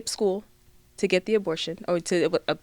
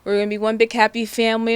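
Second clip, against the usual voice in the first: ordinary talk, nothing marked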